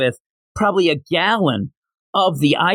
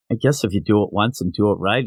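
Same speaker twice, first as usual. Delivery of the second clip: normal talking voice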